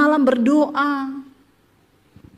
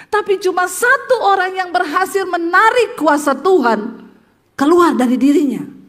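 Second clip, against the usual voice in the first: ordinary talk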